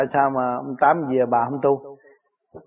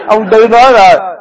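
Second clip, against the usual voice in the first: words burst out through a loud laugh; real amused laughter while speaking; laughing loudly; 7 semitones higher, 12 dB louder than usual. Real, not acted. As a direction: laugh it out, much higher and louder. Laughing while telling story.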